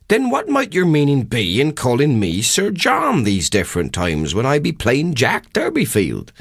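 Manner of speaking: a humble country haggler, half polite, half puzzled; rustic old countryman. Acted. Haggler Durbeyfield questions the parson on the road, rustic and puzzled.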